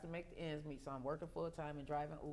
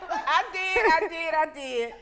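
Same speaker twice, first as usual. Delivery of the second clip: laughing; 9 semitones higher, 15 dB louder, slower than usual. Real, not acted. Laughing answer about airport.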